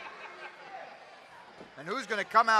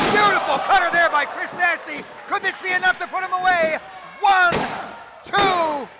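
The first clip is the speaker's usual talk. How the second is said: excited play-by-play; 8 semitones higher, 7 dB louder than usual. Acted. Live play-by-play call.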